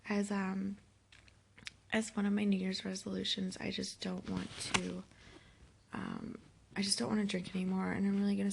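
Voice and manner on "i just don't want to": ordinary talk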